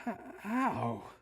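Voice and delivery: high, halting stammer of shock; stunned disbelief. A narrator's reading. John stunned after crash.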